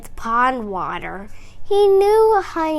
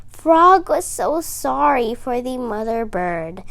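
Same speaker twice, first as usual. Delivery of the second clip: ordinary delivery, nothing marked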